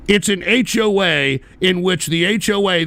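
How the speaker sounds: ordinary delivery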